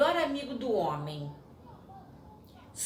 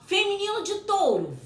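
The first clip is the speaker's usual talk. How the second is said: high, fast eager reveal; excited reveal; 11 semitones higher, 4 dB louder than usual. Real, not acted. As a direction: build up the answer with glee. Revealing quiz answers excitedly. (revelação animada e aguda)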